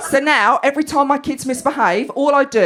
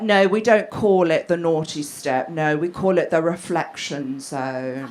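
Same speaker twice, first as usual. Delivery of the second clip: slow, lower mock teacher voice; schoolteacher; 8 semitones lower, 4 dB quieter, slower than usual. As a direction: correct a parent slowly and evenly, imitating a teacher. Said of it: "the teacher was like".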